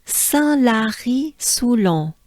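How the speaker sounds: "Saint-Lary-Soulan" slow, French nasals and throaty R; careful teacher model of "Saint-Lary-Soulan"; model reading. Shown as a demonstration. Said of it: "Let me say it once".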